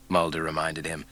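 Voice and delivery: plain reading voice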